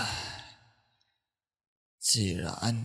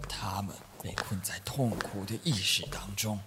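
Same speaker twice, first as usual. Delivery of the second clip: whisper; 7 semitones lower, 8 dB quieter than usual. Acted. Priest explains quietly.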